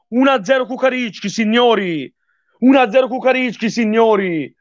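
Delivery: high and fast, elated; thrilled score call. Real, not acted. Announces score after the goal.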